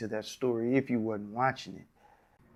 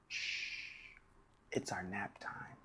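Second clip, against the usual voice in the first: whisper; 4 semitones lower, 12 dB quieter, much slower than usual. Pretend. Hushing class for nap time.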